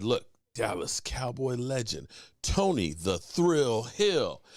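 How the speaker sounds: slow, low, drawn-out introduction; announcer introduction. Retold or imitated. Host presenting a football legend on camera, grandly slow. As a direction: stretch out the name like an announcer.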